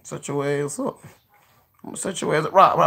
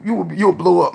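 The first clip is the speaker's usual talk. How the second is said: ordinary talk, nothing marked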